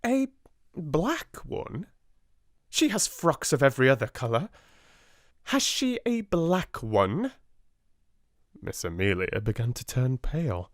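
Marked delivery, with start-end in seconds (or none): 0.0-7.3 sharp. A narrator's reading. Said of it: "Miss Minchin questioning".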